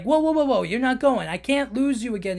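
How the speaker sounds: father stopping his son from going, high and urgent; alarmed father. Retold or imitated. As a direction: stop your son in panic. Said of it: "Marlin's like".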